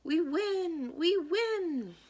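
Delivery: high, loud cheering; excited shout. A narrator's reading. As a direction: celebrate the winning run. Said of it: "Coach Marie and the others shout".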